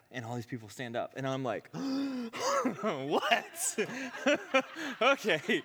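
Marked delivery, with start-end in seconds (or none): 1.7-2.8 loud, high gasp of disbelief; shocked gasp. Retold or imitated. Reacts as crowd stands up. 2.8-5.6 high, loud, laughing "what"; shocked disbelief. Retold or imitated. Reacts as crowd stands up.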